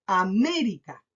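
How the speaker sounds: América with a strong, drawn-out ME; stress on ME. Shown as a demonstration. Teacher showing pupils where the voice is marked, ME stressed in América. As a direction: push ME, let rica fall away. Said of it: "marco la palabra en me".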